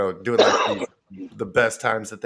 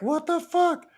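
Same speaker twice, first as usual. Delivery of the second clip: much higher, squeaky incredulous outburst; hammed-up disbelief; mock outrage. Viewers reacting to the bleeped word, mock incredulous. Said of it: "They're like".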